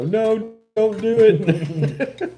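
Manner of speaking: high, drawn-out mock holler; mock yell. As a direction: imitate yelling out a window, stretching it out. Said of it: "yelled out the window".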